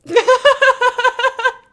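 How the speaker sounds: high, loud cackle burst; loud shrieking laugh. Real, not acted. Streamer cracking up at chat, shrieking laugh.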